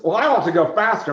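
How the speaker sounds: a lightly voiced eager crewman, quick and loud; mock-eager pilot wanting speed. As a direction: push it out fast and impatient. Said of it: "he goes".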